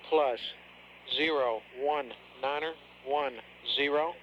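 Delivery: measured, spaced-out digit reading. Real, not acted. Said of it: "reading numbers for copying".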